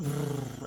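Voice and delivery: lips buzz like a motor revving; engine rev noise. Retold or imitated. Imitating the motorbike revving. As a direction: brrr of a starting engine.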